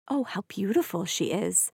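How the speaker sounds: soft, hushed admiring whisper. A narrator's reading. Guests whisper to one another about Cinderella, hushed. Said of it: "whispering to one another".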